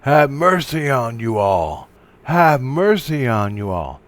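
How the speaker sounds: loud, slow, drawn-out cry; retold scream. Imitating a man screaming out as he goes down, crying out. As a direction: call it out long and loud. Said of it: "he screams out".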